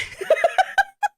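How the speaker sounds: very high, loud burst of laughter; shrieking laugh. Real, not acted. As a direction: cackle in disbelief. Laughing at his reason.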